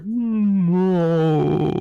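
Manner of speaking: long, high, loud wail of "no"; voice heard from outside. Acted. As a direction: stretch it out into a loud cry. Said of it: "and you just hear".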